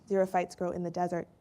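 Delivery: usual speaking voice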